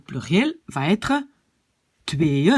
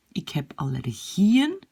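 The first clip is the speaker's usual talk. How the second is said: "Ik heb allergieën" slow, long GIE rising at the end; accent sur le I; slower than usual. Shown as a demonstration. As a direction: lean on GIE in "allergieën", slowly. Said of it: "l'accent est sur le I". (« Ik heb allergieën » lent, GIE allongé)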